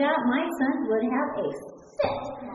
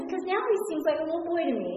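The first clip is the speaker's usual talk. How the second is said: ordinary talk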